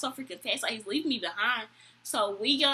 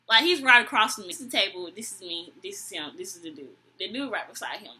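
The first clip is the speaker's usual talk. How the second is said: ordinary delivery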